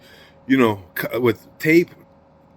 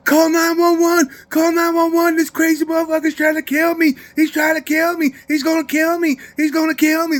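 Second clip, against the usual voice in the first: high-pitched, hammed-up cries for help; mock screaming for help; 4 dB louder than usual. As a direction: imitate a panicked man screaming for rescue. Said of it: "start screaming".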